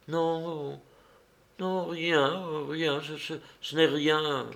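halting, subdued and evasive; faltering denial. A narrator's reading. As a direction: weakly brush off the concern. Vassia evades his friend's concern.

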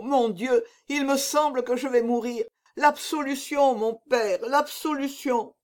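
high, anguished begging; desperate plea. A narrator's reading. Dying executioner begs the monk for absolution, high desperate plea. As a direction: plead as if life is slipping away.

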